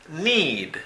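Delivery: "need" with the ee held long; long vowel demo. Shown as a demonstration. Teacher shows learners long sounds in "need", long vowel demo. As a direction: stretch the vowel in "need", falling at the end. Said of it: "¿Ven cómo es largo?".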